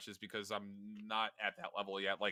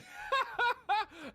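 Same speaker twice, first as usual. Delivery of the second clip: loud, high burst of laughter; helpless laughter; 5 dB louder than usual. Real, not acted. Caster breaks up trying to explain, loud high laughter. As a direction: burst out laughing mid-thought. Cracks up while starting to explain.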